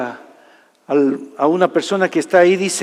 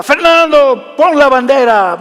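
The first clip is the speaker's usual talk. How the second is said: high, raised call across the house; retold father's shout; 6 semitones higher, 5 dB louder than usual. Father yells orders from his bed to his son, retold shout. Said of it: "me grita desde la cama de él".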